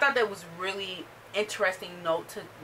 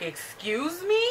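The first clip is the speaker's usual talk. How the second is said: offended excuse me; 7 semitones higher, slower than usual. Retold or imitated. Janice offended by the leader.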